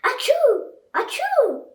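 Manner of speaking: high, loud sneezes. Acted. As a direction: sneeze twice hard. Sneezing sounds in story.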